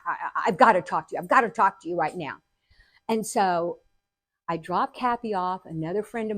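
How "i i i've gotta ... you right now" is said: stammering rush of words; retold frantic phone plea; frantic urgency. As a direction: tumble over yourself urgently, very fast. Frantic call to her dad.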